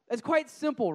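ordinary talk

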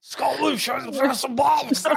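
high, garbled mock Scottish rant; Scottish accent. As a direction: incomprehensible exaggerated Scottish accent. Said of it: "when they really get going".

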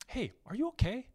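high, gentle, concerned voice of the wife; caring check-in. Retold or imitated. Wife approaches the rude driver.

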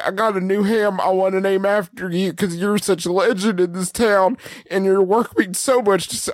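lightly voiced folksy businessman, apologetic; a hint of a humble, worked-up admirer; earnest ham seller Cam. Asking Clint to lend his name to a ham, mildly earnest character voice. Plays Cam on prank call.

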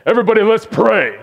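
rally the locker room to pray; captain's call. Retold or imitated. Football captain calls team to prayer.

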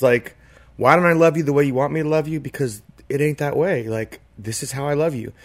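ordinary talk

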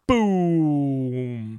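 long, falling "boom" like a blast; imitated explosion. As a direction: draw out a mock explosion, sinking in pitch. Imitates positivity being blown up.

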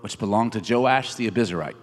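ordinary talk

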